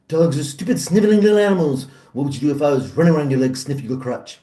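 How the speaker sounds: disdainful cartoon tycoon impression; quick, sneering cartoon villain; Mr. Burns. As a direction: rattle it off as a scornful old boss. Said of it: "One of my favorite episodes of Simpsons".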